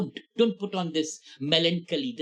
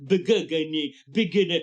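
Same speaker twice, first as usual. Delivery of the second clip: mock stammer; 3 dB louder than usual. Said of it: "you'll say".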